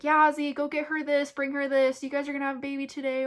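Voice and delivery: doctor cheerfully urging husband, excited. Retold or imitated. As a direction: call it out brightly like the doctor.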